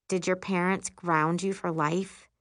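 ordinary delivery

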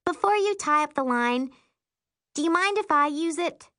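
high-pitched, polite younger sister. Acted. Sister asking to use the phone, high voice.

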